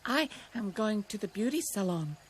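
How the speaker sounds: a touch of panting between words; panting rabbit. A narrator's reading. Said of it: "Roxy panted".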